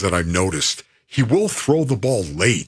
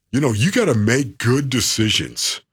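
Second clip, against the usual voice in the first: ordinary delivery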